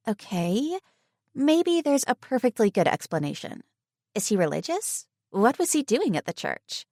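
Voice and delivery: high, calming friend voice; reassuring friend. Acted. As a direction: offer a good explanation. Tegan reassuring.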